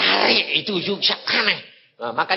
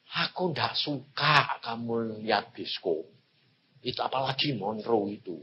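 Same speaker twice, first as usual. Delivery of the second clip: voice of God; 5 semitones lower, 7 dB quieter than usual. Retold or imitated. God's voice retold in sermon.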